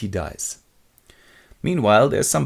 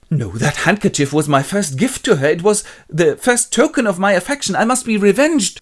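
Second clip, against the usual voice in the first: hammed-up rage climbing in pitch; outraged; 9 semitones higher, 5 dB louder than usual. Jealous husband hears of his gift given away, mock outrage. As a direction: build to a loud vow of revenge. Othello enraged, vows revenge.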